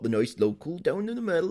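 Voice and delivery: lightly mocked local country accent; a hint of a nice local's accent. Co-host listing film sheep's accents, light local accent. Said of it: "had a randomly different accent".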